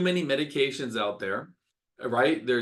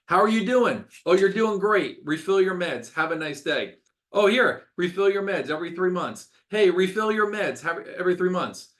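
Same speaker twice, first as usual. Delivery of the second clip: higher, louder brush-off doctor voice; mock hurried doctor; 5 semitones higher, 4 dB louder than usual. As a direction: imitate a doctor rushing patients out. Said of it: "Every time they go to their doctor".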